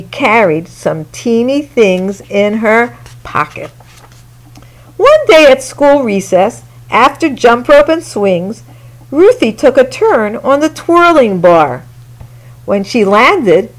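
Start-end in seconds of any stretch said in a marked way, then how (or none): none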